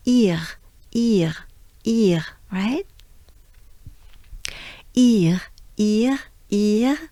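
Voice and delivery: ir with a throaty French r; French ir sound. Shown as a demonstration. Teacher drilling the ir sound. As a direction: repeat ir, rolling it in the throat. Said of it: "So we have".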